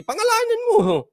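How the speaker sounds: very high mocking imitation. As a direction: mimic someone hiking their voice way up. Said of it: "pataas-taas ka ng boses". (panggagaya ng pataas na boses)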